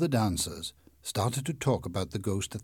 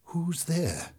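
high, scared and trembling; 8 semitones higher than usual. A narrator's reading. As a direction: quaver it out in fear. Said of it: "asked in a trembling voice".

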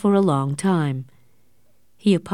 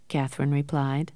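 ordinary delivery, nothing marked

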